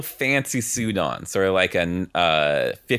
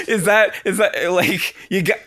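high giggling disbelief; laughing; 9 semitones higher, 4 dB louder than usual. Real, not acted. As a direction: laugh through the words.

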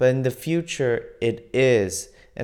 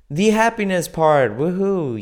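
excited; 6 semitones higher, 6 dB louder than usual. Real, not acted. Cheering new topic.

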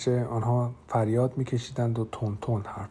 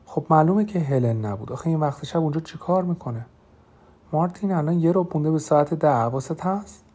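higher-pitched, incredulous protest; incredulous remonstrance; 5 semitones higher, 4 dB louder than usual. A narrator's reading. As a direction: talk sense into a panicking friend. Dan incredulous at Martin's fear.

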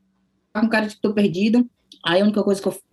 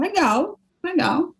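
cheerful fake-fluent legal; 10 semitones higher, much slower than usual. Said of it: "eu falava".

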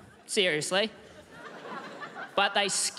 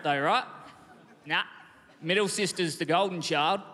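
ordinary talk, nothing marked

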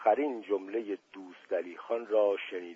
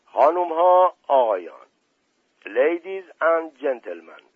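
mock formal announcer; 3 semitones higher, 6 dB louder than usual. Prince mockingly introduces Dust Ali Khan as speaker, grand and loud. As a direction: announce him like a master of ceremonies. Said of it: "قیافهٔ جدی به خود گرفت".

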